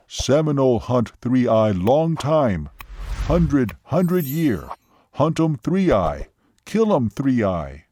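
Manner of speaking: slow, emphatic, broken-English declarations; imitated Seminole man. Seminole tells waitress of the long hunt, slow and emphatic. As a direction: punch each short phrase slowly as the Seminole. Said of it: "even imitating his voice and gestures".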